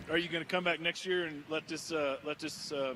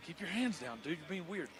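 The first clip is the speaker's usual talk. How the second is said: quiet aside; 10 dB quieter than usual. Real, not acted. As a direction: say it under your breath, off mic. Aside to guest during interview.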